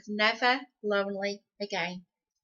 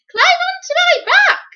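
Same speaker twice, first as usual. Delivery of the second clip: very high, loud squeaky helper voice; squeaky giraffe voice; 14 dB louder than usual. Acted. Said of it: "he squeaked in his high voice".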